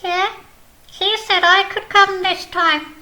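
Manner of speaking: high, cheeky little snail voice counting; Snail puppet voice. Acted. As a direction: play a chirpy snail puppet showing off.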